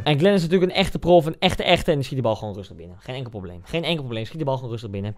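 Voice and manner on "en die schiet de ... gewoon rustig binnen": much softer, lower, calm; laid-back praise of the pro; quiet. Real, not acted. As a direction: say it coolly, no fuss.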